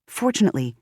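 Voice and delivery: plain reading voice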